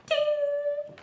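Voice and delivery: high bright ding like a bell; ding sound. Pretend. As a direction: ring out the idea like a bell. Bright idea sound effect.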